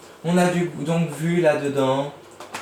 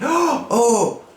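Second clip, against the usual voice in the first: very high, loud gasp of surprise; surprised oh; 10 semitones higher, 4 dB louder than usual. Shown as a demonstration. Teacher demoing surprise to pupils. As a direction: startle on each oh. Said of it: "qui se surprend".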